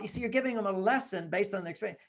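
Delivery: usual speaking voice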